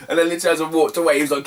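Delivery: ordinary delivery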